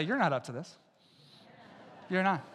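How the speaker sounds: soft, low, casual you're not up to this; quiet aside to neighbor. Shown as a demonstration. Congregation member telling a neighbor, softly. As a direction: say it softly to the person beside you. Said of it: "Turn to your neighbor and say".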